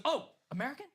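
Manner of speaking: high, rising mock surprise; sudden realization. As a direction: imitate someone suddenly backing off. Guard realizes he is American.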